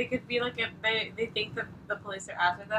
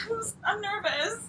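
mock scared girl; 8 semitones higher than usual. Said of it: "she would be like".

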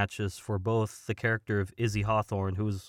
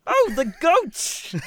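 very high, loud, drawn-out cry in character; comic exclamation; 6 dB louder, slower than usual. Acted. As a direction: cry out slowly, pitched way up. Korg bit, exclaiming about goats.

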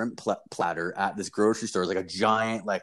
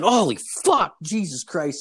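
high, loud retold yelp of shock; pained shock; 7 semitones higher, 4 dB louder than usual. Storyteller reacts to raccoon biting his toe, shocked yell. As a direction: curse as if just bitten. Acting out being bitten by raccoon.

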